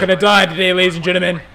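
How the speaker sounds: loud, hyped-up rallying cry; excited shout. Real, not acted. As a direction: shout it out like the crowd's cheering you on. Tense landing, hyped announcement.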